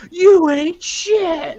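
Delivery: high, loud, drawn-out sneering taunt; villain snarl. Retold or imitated. Arm wrestler taunting his opponent, slow loud sneer. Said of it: "he leans in and goes".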